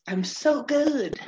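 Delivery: mock self-satisfied Joey; smug. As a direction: savor the boast slowly. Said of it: "she's like".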